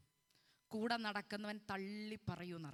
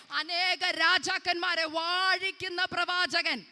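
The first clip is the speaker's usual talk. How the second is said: loud, high prophetic proclamation; full-force prophetic preaching; 8 semitones higher, 17 dB louder than usual. Acted. Preacher announces a child prophet to a barren woman, full-force proclamation. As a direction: proclaim it at full volume, pitch raised.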